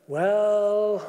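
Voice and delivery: wife doubting her husband's new faith, unsure well; doubtful well. Retold or imitated. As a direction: let the "Well," hang with doubt. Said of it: "the woman said rather doubtfully".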